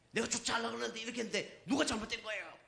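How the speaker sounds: high, rushed imitation of a quarrelling driver; mock aggrieved appeal. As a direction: plead your side to a bystander, hammed-up. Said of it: "두 사람 다 자기 편 들어달라고". (높고 빠르게 억울함을 따지는 흉내)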